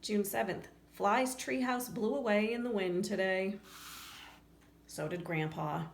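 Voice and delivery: ordinary delivery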